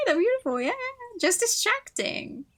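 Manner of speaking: squeaky flustered voice; flustered, pitch jumps up; high flustered. Acted.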